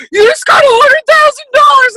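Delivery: high, loud shouted congratulations; mock excited shout. Team members shouting congratulations over her big bonus, high and loud. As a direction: yell it like the whole team is cheering you on. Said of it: "everyone was like".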